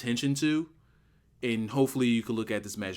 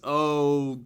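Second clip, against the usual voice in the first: ordinary talk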